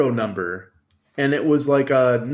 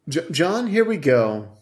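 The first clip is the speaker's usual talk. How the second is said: ordinary talk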